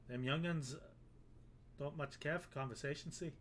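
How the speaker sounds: a hammed-up, horrible Irish brogue; bad Irish accent. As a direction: play the Irish sailor, badly on purpose. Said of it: "I've made him Irish".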